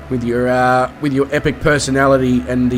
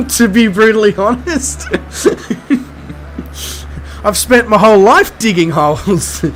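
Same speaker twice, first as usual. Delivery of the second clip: higher-pitched, laughing through the words; 6 semitones higher than usual. Real, not acted. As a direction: break into laughter as you admit it. Laughing while admitting he doesn't care.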